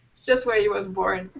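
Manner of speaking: ordinary delivery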